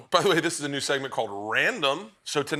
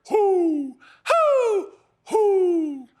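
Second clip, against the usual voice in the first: high loud hoot, hoot, hoot; repeated owl hoots; 6 dB louder, much slower than usual. Retold or imitated. The other old man answering from his dock, high loud hoots. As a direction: keep hooting like an owl calling back. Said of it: "And we hear".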